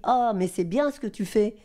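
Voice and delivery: lightly voiced enthusiastic woman. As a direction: lightly act out an encouraging colleague. Woman eagerly offers her a job.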